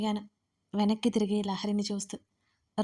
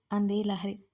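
ordinary delivery

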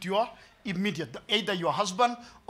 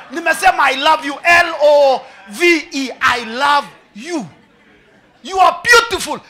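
shouting the declaration, slow and spelled out; hammed-up shouted I love you; mock exasperated yell; 6 semitones higher, 13 dB louder, slower than usual. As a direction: yell it letter by letter in frustration. Man insisting I love you.

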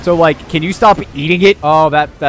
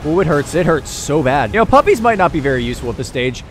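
ordinary talk, nothing marked

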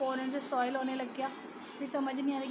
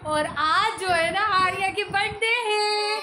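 excited; 6 semitones higher, 7 dB louder, slower than usual. Real, not acted. Midnight birthday announcement, excited.

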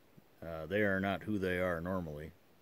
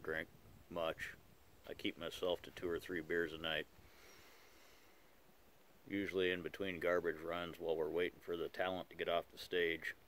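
talking around pipe stem; pipe in mouth. Real, not acted. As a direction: lips gripping pipe. Seen 3: Pipe clenched in mouth while talking until about 15s, then removed.

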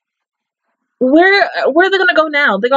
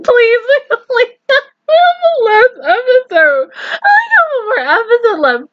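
much higher, half-laughing, half-crying plea; whiny plea; 12 semitones higher than usual. Real, not acted. Viewer begging the anime for a happy ending, near tears. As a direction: beg the show not to hurt you.

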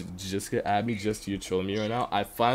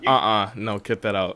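ordinary talk

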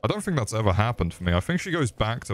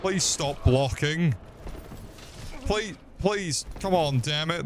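frustrated pleading; 8 semitones higher, 3 dB quieter than usual. Real, not acted. Frustrated at a blocking enemy.

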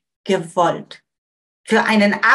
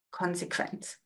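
hushed whisper; 4 semitones lower, 14 dB quieter than usual. Shown as a demonstration. Teacher to audience, hushed whisper of the verdict. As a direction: breathe the word out, barely voiced.

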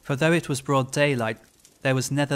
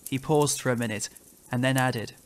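usual speaking voice

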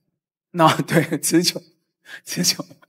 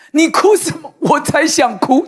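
exasperated retort; 8 semitones higher, 5 dB louder than usual. Retold or imitated. Quarrel, annoyed at crying wife.

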